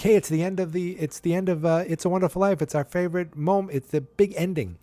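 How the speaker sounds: high, eager imitation of a crowd; excited announcing. Bar crowd as the favorite movie ends, excited announcing.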